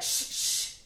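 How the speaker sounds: voiceless shh, shh, shh; shushing. Retold or imitated. Recapping a TV scene, imitating Maitland hushing the crew. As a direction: hush everyone urgently.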